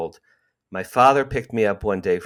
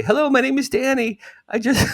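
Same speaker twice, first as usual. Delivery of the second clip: put-on much higher boyish voice, a little louder; thirteen-year-old voice; 4 dB louder than usual. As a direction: play yourself at thirteen calling the paper. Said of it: "I'm doing my thirteen voice".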